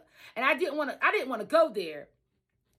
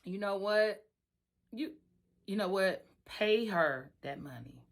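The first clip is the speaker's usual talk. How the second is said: lower, softer steady advice voice; best friend's calm advice; 3 semitones lower, 6 dB quieter than usual. Retold or imitated. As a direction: talk her down, calm and low. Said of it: "on the phone with my best friend".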